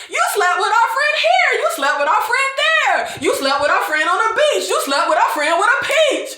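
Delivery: high sing-song mock voice; mocking the fans. Fans to Shay, high mocking sing-song. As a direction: chant the list like a nursery rhyme. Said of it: "the fans are like".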